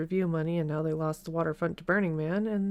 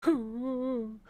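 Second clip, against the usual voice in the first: cooing at a pet; 5 semitones higher, much slower than usual. Real, not acted. Cooing at the crying dog.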